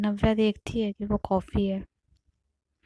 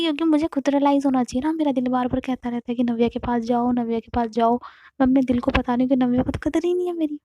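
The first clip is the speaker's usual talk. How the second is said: higher, louder fast rant; dramatic rant; 4 semitones higher, 5 dB louder, faster than usual. Acted. Aarti telling Navya about her heart, a fast loud rant. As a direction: pour it out breathlessly.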